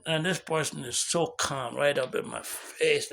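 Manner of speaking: usual speaking voice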